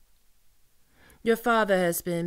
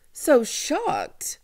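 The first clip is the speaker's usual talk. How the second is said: mocking; 7 semitones higher, 6 dB louder than usual. Mocking captive.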